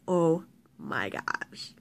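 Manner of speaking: low, soft, slow imitation of a defeated brother; worn-down exasperation. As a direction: mutter it slowly, giving up.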